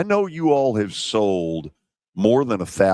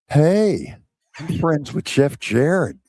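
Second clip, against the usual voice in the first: lightly voiced impression of a buddy, pitched up; a hint of Frank's friendly greeting, higher; light impersonation of the late tight end, pitched up; Frank Wycheck impression; 6 semitones higher than usual. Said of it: "You do impersonations well".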